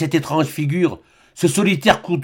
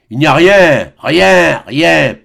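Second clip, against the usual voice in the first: each rien louder, weighty and falling; solemn repetition; 6 dB louder, much slower than usual. Acted. Old man gesturing at the horizon, solemnly repeating rien. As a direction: pronounce it like a final verdict. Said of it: "en répétant solennellement".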